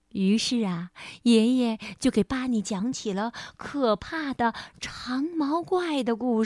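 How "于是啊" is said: usual speaking voice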